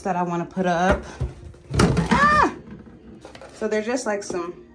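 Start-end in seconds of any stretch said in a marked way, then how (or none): 1.8-2.5 high, loud startled "Ah!"; a sudden sharp cry; surprised yelp. Real, not acted. Sudden exclamation on camera.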